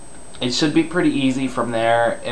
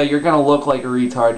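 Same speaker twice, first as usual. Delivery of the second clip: usual speaking voice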